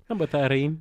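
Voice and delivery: high, rising New Zealand "thirteen"; amused mock Kiwi "thirteen", middle T softened; Kiwi accent. Shown as a demonstration. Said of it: "the way they say the number thirteen".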